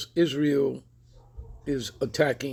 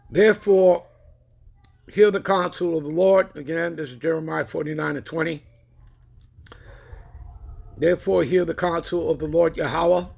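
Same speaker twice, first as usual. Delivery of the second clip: scripture reading; 3 semitones higher, 4 dB louder than usual. Acted. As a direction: proclaim the verse from the pulpit. Reads scripture aloud.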